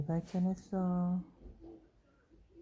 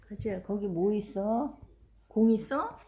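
normal talking voice

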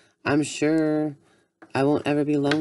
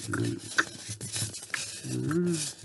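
ordinary talk

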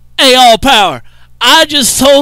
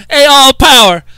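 ordinary talk, nothing marked

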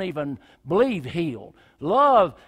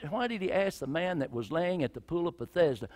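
usual speaking voice

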